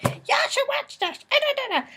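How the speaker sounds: a playful parody of a pushy fan, sung high; mock pushy fan. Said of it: "I'm not like".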